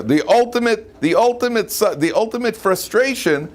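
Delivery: ordinary talk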